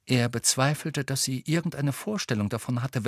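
ordinary delivery

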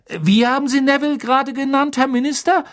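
high, loud and agitated; excited question. A narrator's reading. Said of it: "fragte er aufgeregt".

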